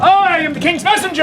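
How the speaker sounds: high, grand herald's announcement; King's messenger. Acted. Messenger introducing herself to the McDuffs, high proclamation. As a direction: announce yourself like a royal herald. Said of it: "She strikes a dramatic pose".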